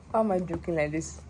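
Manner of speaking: normal talking voice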